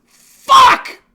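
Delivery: loud panicked yelling; startled shouting. Real, not acted. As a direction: yell in sudden alarm. Sudden panic on stream.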